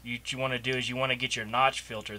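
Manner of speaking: normal talking voice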